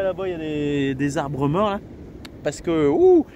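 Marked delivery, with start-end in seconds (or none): 2.9-3.2 shivering in the cold wind. Real, not acted. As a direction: talk while shivering from the cold. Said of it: "ça caille".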